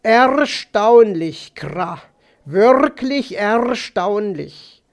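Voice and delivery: slow, drawn-out croak with rolled r; amazed raven. Acted. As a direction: marvel slowly as a cawing raven. (staunend krächzender Rabe)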